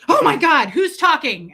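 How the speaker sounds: high, loud alarmed cartoon cry; frightened little voice in the night; voicing a startled boob. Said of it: "they'll be like".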